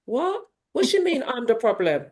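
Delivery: jumps high in indignant surprise on What; affronted, as if you can't believe it; vexed disbelief. Retold or imitated. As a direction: coach has just blamed her, indignant What. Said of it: "I was vexed".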